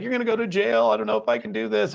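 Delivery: high-pitched imitation of a worried partner; wife's worried quote. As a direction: raise your pitch high and fret like a scared girlfriend. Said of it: "She's like".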